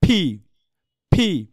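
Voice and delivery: "ফি" as aspirated P, drawn out; letter-name demo. Shown as a demonstration. Teacher gives the spelling name of the letter, pronunciation demo. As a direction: say "ফি" long with a puff. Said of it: "আপনাকে বলতে হবে কি".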